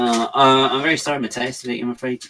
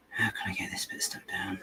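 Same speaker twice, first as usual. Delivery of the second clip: hushed whisper to herself over a fiddly bit; 10 dB quieter than usual. Real, not acted. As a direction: breathe the question out, no voice. Muttering to herself over a fiddly piece.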